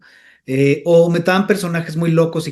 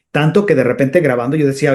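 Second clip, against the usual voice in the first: usual speaking voice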